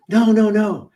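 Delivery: high, rushed mock schoolmate insisting; mock eager old classmate; eager correction. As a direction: jump in to fix the name. Bully correcting his name.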